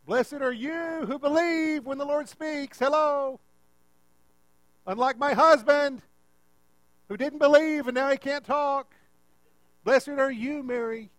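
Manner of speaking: high, loud proclamation meant to be overheard; hammed-up wife jabbing at her husband; extra loud. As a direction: project pointedly so someone nearby hears. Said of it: "said that extra loud".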